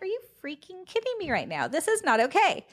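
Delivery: indignant retold outburst. Retelling her reaction to the snub, high mock indignation. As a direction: play up the outrage, half mocking yourself.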